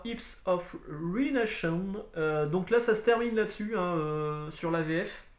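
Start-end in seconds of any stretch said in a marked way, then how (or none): 0.0-2.0 slow, drawn-out French-accented English. Real, not acted. Vlogger reading the third title, slow French-accented English. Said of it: "mon magnifique accent anglais".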